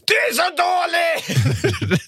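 put-on shrill child's scream, very high; screaming inner child. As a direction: shriek it like a nasty little kid. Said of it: "ungen som bara skriker".

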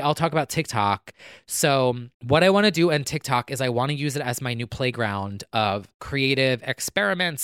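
usual speaking voice

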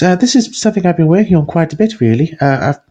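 high, fluttery nervous shopkeeper voice; wiry woman. Acted. Game master voicing the flustered smith's wife. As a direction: play her flustered and eager to please.

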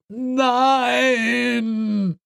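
a high cry of horror; horrified cry. A narrator's reading. John cries out in his nightmare, horrified. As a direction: nightmare scream, hammed-up.

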